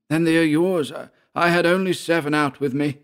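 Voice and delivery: high-pitched character voice; Georg. A narrator's reading. Georg reasons whose men they are.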